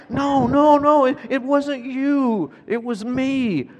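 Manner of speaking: high, drawn-out, pleading insistence; insistent correction. Retold or imitated. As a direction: protest earnestly that the blame is yours. Father urgently correcting his son.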